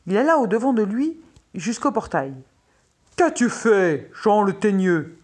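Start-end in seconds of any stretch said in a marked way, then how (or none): 3.2-5.2 loud, stern royal demand; furious king. A narrator's reading. King confronts Jean at the gate, furious. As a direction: call the culprit to account, loudly. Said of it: "et qui paraissait furieux".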